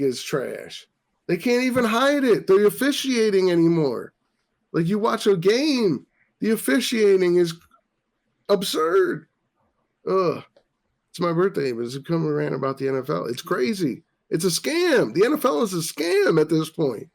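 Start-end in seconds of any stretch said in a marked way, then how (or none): 1.3-10.4 higher, louder fed-up complaining; exasperated rant. Real, not acted. Ranting about NFL refs. 13.3-17.1 indignant. Real, not acted. Declaring NFL a scam.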